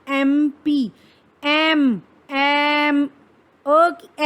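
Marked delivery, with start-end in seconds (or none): 0.1-3.0 एम drawn out on its first "ae" vowel; lengthened "ae" opening in एम; opening vowel shown. Shown as a demonstration. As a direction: say एम P slowly, holding the vowel that starts एम. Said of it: "इसकी sound कैसी आ रही है".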